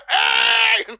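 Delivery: high, loud, drawn-out "hey"; mock Canadian climax. Joker shows the Canadian A-face, mock climactic shout. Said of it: "it's the Canadian A face".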